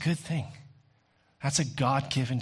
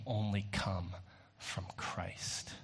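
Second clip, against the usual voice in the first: whispered, much softer, intimate close; 4 semitones lower, 10 dB quieter, slower than usual. Acted. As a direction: drop to a breathy whisper on the final words. Sermon point drops to hush.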